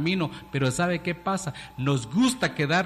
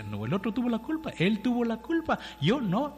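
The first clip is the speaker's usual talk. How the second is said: higher, fast, soft denial; defensive excuse; 6 semitones higher, 4 dB quieter than usual. Retold or imitated. Person used to guilt denying it, quick and higher. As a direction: brush off the blame quickly and whiny. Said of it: "Sí, y dice".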